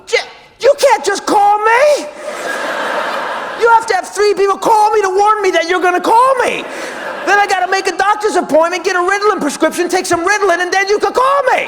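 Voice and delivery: high, loud freak-out; hysterical fan rant. Acted. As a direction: shout it in starstruck panic. Overwhelmed fan rants at famous caller.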